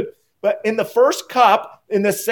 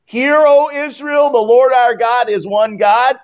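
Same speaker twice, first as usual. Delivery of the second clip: loud, high, solemn proclamation; ringing liturgical declaration; recited Shema; 5 semitones higher, 5 dB louder than usual. Shown as a demonstration. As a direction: proclaim it like a call to the people. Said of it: "you remember the Shema?".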